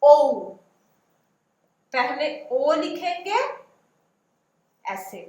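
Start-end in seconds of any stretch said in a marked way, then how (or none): 0.0-0.4 ओ held and loud; modelled vowel. Shown as a demonstration. Teacher sounding out ओ for pupils. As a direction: sound out ओ clearly. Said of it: "उसके बाद ऐसे".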